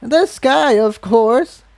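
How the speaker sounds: as if it's obvious; old mare answering as if it's obvious; matter-of-fact. Acted. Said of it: "as if it was the most natural thing in the world".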